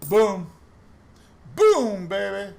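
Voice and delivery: loud, punchy celebration shout; triumphant shout. Real, not acted. Coin hunter cheering his find, a triumphant shout. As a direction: belt it out like you just won.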